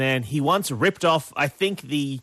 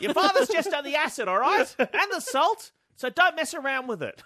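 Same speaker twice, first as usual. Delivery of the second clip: mock fussy mum; 10 semitones higher than usual. Mum warning about the pool acid, high laughing mock nag.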